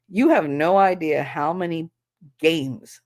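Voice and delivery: ordinary talk, nothing marked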